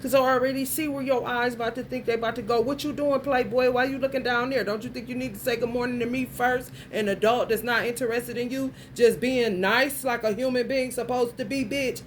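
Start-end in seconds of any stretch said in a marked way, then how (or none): none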